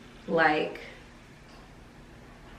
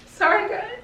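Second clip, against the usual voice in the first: real tears breaking through the apology; crying apology; 14 semitones higher than usual. Real, not acted. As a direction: voice cracking high as the tears come. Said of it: "I don't like crying on camera".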